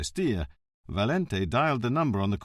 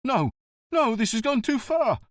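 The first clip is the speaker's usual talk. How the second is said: higher, rushed upset protest; a cornered man losing his nerve; agitated denial pitched up; 14 semitones higher, 5 dB louder than usual. Acted. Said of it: "said Prestia, very upset".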